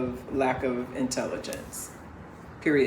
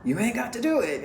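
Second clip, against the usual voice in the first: higher, quick imitation of a granddad; imitated granddaddy; 8 semitones higher, faster than usual. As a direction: play granddad urging her, pitched up and fast. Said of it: "my granddaddy being like".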